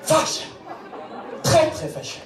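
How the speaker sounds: "fâche" said high, German accent, harsh and clipped; German-accented French. Shown as a demonstration. Mocking a German-style pronunciation, "très, très fâche" with German accent. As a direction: say "fâche" the way a German would pronounce it. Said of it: "tu la prononces un peu à l'allemande".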